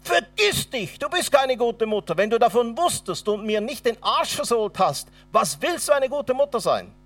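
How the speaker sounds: high, loud furious counterattack; a retold rage at mother, high and loud; angry outburst. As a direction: lash out at your mother, pitch climbing.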